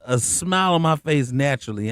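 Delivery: ordinary talk, nothing marked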